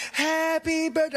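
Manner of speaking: high, slow, sing-song birthday wish; hammed-up fake cheer; mock party greeting. Joyless party guests.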